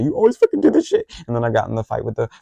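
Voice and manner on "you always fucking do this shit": mock high-pitched crying complaint; imitating a crying classmate, much higher; upset classmate. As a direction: squeal it like a classmate in tears. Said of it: "they were like".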